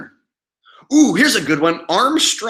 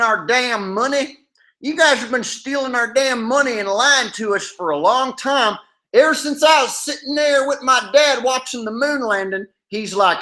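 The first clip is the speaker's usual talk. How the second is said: higher-pitched angry Okie hillbilly caller; 5 semitones higher than usual. Pretend. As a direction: rant like a fed-up hillbilly from Oklahoma. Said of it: "my go-to Okie hillbilly voice".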